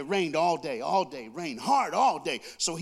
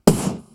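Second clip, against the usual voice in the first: sudden loud puffed "poof"; imitated strange noise; 13 dB louder than usual. Preacher imitates an odd house noise, explosive puff. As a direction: burst it out like a bump in the night. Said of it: "you hear something like".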